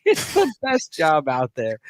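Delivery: slow, hammed-up Cajun accent; drawn-out mock Cajun through a laugh; Bobby Boucher. Host finishes the coach's quote, laughing mock Cajun. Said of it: "That's some Bobby Boucher".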